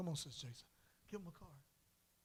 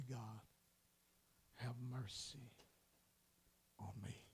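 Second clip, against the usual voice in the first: low, slow, hushed plea; humble prayer; 4 semitones lower, 8 dB quieter, slower than usual. Acted. Humble man begging God for mercy, low and slow. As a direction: sink low and plead slowly.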